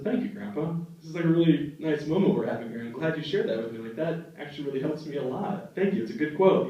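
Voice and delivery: soft sincere thanks. Retold or imitated. Grateful reply, getting softer.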